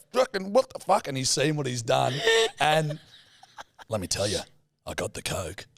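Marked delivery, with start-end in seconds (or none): 0.1-1.1 shocked dad. Retold or imitated. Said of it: "he's like".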